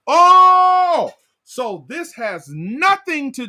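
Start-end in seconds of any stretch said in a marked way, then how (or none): 0.1-1.1 high loud "Oh" of realization; a big, ringing oh of discovery; exclaimed oh. Acted. Sudden realization in sermon.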